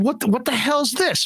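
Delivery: high, indignant cry; retold outrage. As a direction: act outraged at the haircut. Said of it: "I said".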